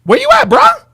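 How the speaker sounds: high-pitched taunting call; impatient, high challenge; calling out a challenger. Real, not acted. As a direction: call them out, pitch shooting up on "bruh".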